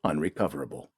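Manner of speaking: clipped official log entry read out; ship log readout. Acted. Narrator reading the cruiser's log, measured official tone. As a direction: read it like a terse military record.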